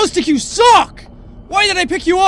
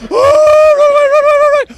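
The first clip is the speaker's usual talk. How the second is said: high loud panicked running; scared horror game streamer; 9 semitones higher, 5 dB louder than usual. Real, not acted. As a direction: yell run in panic.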